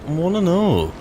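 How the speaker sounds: high, loud eager curiosity; itching to see what's inside. Real, not acted. Player peering into cave, eager and loud.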